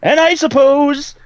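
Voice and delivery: high, drawn-out holler; match the other character's stretched shout; shouted character speech. Acted. Player following another character's speech, high slow holler. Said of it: "following his speech rather than that".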